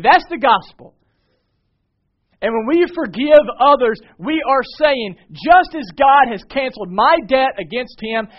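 high, loud, driving sermon delivery; impassioned preacher, pitched high and loud; full-force preaching. Real, not acted. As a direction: proclaim the gospel at the top of your voice.

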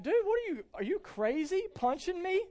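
joking dad scolding his two-year-old, mock outraged. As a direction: fake outrage at a tiny kid. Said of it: "And be like".

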